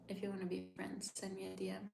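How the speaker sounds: soft whispered aside; whispered invite. Real, not acted. Streamer inviting viewer to message her, whispering. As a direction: tease it under your breath.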